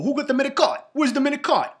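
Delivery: hammed-up New York Italian uncle calling out, ending swallowed; New York Italian uncle accent. An uncle on the show calling for food in a New York Italian accent. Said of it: "the uncle's going".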